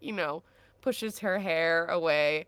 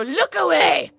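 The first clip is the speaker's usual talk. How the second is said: loud, high yell at onlookers; protective shout; 11 semitones higher, 12 dB louder than usual. Acted. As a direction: shout the bystanders off. Orders bystanders not to watch.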